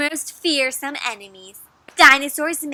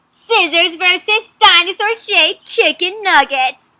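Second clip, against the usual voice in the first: louder, slow fight announcement; matchup announcer; 3 semitones higher, 6 dB louder than usual. Acted. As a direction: announce the big battle.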